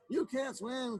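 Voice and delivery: a hint of a quacky duck; quacking duck. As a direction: talk back like a cross duck. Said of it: "quacked the duck".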